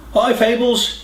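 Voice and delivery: called out high across the house; cheerful call to his girlfriend. A narrator's reading. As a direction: call to her from the other room. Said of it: "he called".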